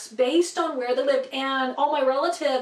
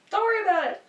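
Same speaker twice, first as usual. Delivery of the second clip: the same words again, pitched much higher and teasing; higher playful repeat; 7 semitones higher, much faster than usual. Real, not acted. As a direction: bounce it back up lightly. Reassuring the listeners twice.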